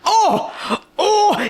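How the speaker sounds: high, sharp exclamation; startled reader gasping; surprised gasp. Real, not acted. Gasp at a plot moment.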